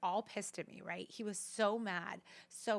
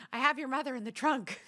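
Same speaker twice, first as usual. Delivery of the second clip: said through a laugh, higher and louder; laughing quote; 5 semitones higher, 5 dB louder than usual. Retold or imitated. Daughter quoting dad's ashes joke, laughing through it. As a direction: crack up while quoting dad. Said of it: "he goes".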